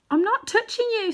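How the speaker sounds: put-on insist, indignant boy; boy protesting. A narrator's reading. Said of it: "said Diggory".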